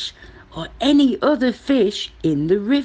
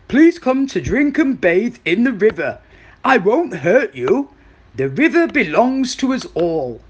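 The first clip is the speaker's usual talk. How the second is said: slightly louder crocodile character voice; friendly crocodile; 3 semitones higher, 4 dB louder than usual. Acted. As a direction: invite everyone as the reformed crocodile.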